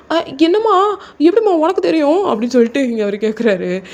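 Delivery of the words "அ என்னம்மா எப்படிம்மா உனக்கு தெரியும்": high, louder startled question; surprised son asking his mother how she knew; caught out. Acted. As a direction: blurt it like a son who's just been found out.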